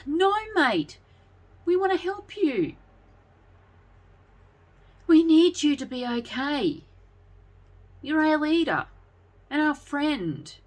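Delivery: put-on high, pleading boy's voice; begging. A narrator's reading. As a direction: plead with your friend to be okay. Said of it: "he begged of Daniel".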